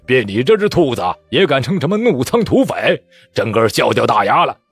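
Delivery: mocking laugh. Bandit mocking a captured rebel.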